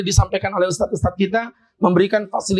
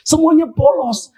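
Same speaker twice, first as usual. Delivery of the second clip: shocked; 6 semitones higher, 5 dB louder, slower than usual. Retold or imitated. Surprise at the missing minaret.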